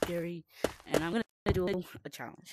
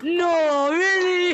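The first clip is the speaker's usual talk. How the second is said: startled shout; 10 semitones higher, 13 dB louder, much slower than usual. Real, not acted. Loud startled reply.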